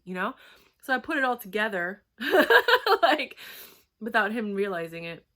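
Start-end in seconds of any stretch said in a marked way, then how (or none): 2.2-3.0 sharp high burst of laughter; loud high laugh. Real, not acted. Realizing why he stood her up, loud laugh. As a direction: let out a loud, high cackle.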